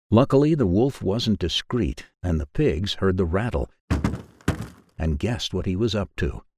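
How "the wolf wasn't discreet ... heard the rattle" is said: plain reading voice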